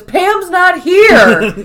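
very slow, high and loud, laughing through it; hammed-up Dwight. Dwight telling Jim that Pam is absent, slow and high. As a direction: drag it out in a mock-worried Dwight voice.

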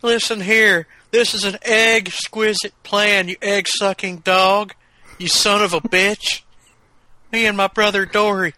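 high-pitched, cantankerous wrestler villain; ornery old wrestler scheming as Egghead; high voice, spitting out insults; Terry Funk impression. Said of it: "if Terry Funk were gonna play".

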